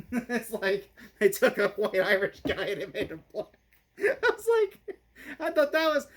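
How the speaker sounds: laughing through the words, pitch climbing high; can't get it out for laughing; real helpless giggling while talking; cracking up. Real, not acted. Cracks up at own whitewashing joke.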